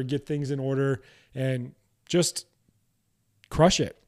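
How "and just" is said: ordinary delivery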